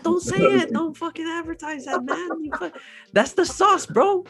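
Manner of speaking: high-pitched, hammed-up scolding; mock outrage. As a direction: squeal it like a betrayed insider. Mock scolding YouTubers for telling secrets.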